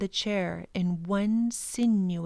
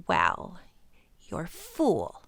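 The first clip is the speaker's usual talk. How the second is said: rude; slower than usual. Acted. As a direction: spit the insult out at him. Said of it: "said the Proc rudely".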